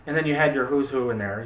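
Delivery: ordinary talk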